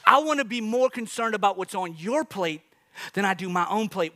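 ordinary delivery

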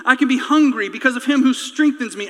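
ordinary talk, nothing marked

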